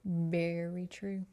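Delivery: slow, knowing "Very true."; slow emphatic repeat; drawn-out agreement. Shown as a demonstration. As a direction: stretch it out like a verdict.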